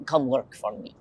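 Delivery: lightly put-on Russian accent; quick jokey Russian accent. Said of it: "I should have said that in a Russian accent".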